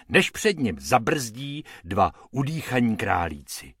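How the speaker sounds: usual speaking voice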